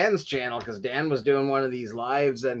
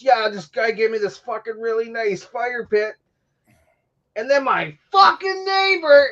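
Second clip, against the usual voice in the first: high-pitched retold rant, building louder; imitated yelling; 6 semitones higher, 3 dB louder than usual. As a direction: mimic his griping, rising into a mock yell. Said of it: "and he's, like, yelling".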